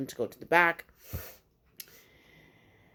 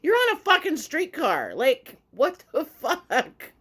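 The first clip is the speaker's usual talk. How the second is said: high, loud mock exasperation with laughter at the end; exasperated yell, last words drawn out; retold incredulous outburst; 10 semitones higher, 5 dB louder, slower than usual. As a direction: imitate a fed-up driver yelling back. Driver's incredulous retort, retold.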